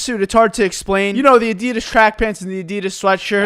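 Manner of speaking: ordinary talk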